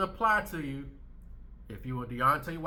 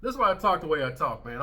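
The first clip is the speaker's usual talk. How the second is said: normal talking voice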